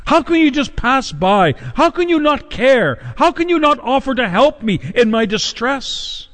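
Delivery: high-pitched, anguished reproach; pleading widow. Acted. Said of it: "It's as though she was saying".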